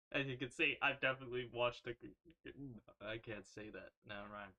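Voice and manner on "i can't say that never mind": much softer, muttered aside; hushed aside. Real, not acted. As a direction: drop your voice, back off. Catches herself, drops voice.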